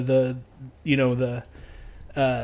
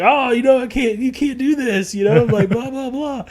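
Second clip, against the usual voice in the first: higher, pleading roommate voice; mock scolding roommate; 8 semitones higher than usual. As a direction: put on the roommate's protest. Roommate voiced in retold party.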